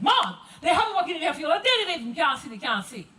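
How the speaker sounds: high, loud Gullah field-worker's talk; Gullah dialect. Acted. Field worker complaining to Mom about the long day, Gullah dialect. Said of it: "you may have heard this conversation".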